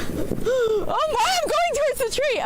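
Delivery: high excited alarm mid-ride; yelping while sliding toward a tree; sledding downhill. Real, not acted.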